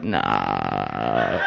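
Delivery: deep mock-casual denial. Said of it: "And I was like".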